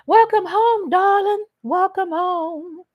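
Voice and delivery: high, sing-song welcome, slowing to a drawn-out croon; sweet welcome. Real, not acted. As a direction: croon the welcome to a dear viewer. Greeting a viewer affectionately.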